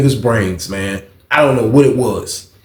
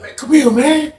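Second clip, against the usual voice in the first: insistent summons; 12 semitones higher than usual. Retold or imitated. Old head insists he come over.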